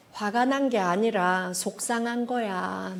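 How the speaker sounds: soft, slow admission of hurt; quiet, slowed reassurance; gentle explanation. Shown as a demonstration. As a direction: explain it tenderly, unhurried. Models gently correcting the question.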